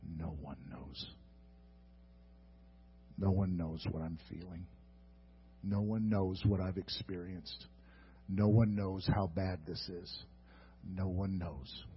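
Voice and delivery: hushed, broken murmur; despairing whisper-soft lament. Acted. Lonely soul murmuring to himself, hushed and hopeless. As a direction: let it sink into quiet despair.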